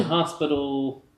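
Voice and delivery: ordinary delivery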